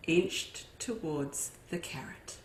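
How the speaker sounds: plain reading voice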